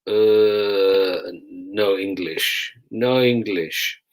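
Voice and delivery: slow stubborn "no English, no English"; obstructive detainee. Retold or imitated. Arrested man stonewalling the sergeant, slow feigned incomprehension. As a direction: play dumb slowly, a put-on foreign voice. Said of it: "deliberately obstructive and saying".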